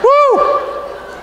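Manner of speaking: high, laughing "woo" whoop; joyful whoop. Real, not acted. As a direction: let out a big happy "woo". Preacher whoops at friend.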